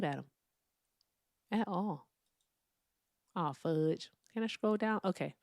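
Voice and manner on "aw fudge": ordinary talk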